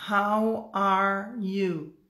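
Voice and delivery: extremely slow, drawn-out textbook English; unnaturally slow. Shown as a demonstration. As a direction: stretch each word out, one at a time. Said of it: "Ninguém fala nesse speed".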